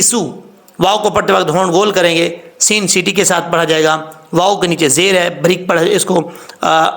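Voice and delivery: ordinary delivery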